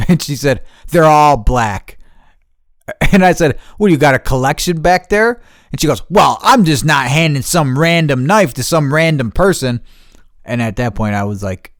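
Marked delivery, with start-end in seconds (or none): 0.9-2.0 loud, slow, higher-pitched retort; mock stonewalling reply; curt retold comeback. Retells receptionist's curt comeback. 3.8-5.4 loud, high incredulous question; retold snarky comeback; sarcastic retort. Retells own sarcastic retort to receptionist. 6.1-9.8 loud, higher, indignant clerk voice; mock officious protest; defensive receptionist impression. Imitates defensive receptionist arguing.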